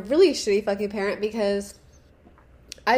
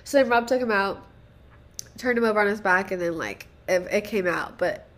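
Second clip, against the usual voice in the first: ordinary talk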